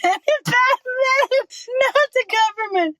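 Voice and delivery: high, fast and laughing; the punchline breaks into giggles; quick squeaky laugh-talk. Real, not acted. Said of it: "Sorry, that was funny".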